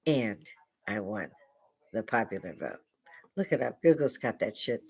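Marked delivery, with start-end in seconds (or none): 0.1-2.8 quiet repeat. Retold or imitated. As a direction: drop it down low and measured. Repeats the quote as an aside.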